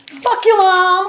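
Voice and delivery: high, loud bratty yell; mock bratty kid. Mimicking a rude kid shouting at his mom, high and loud.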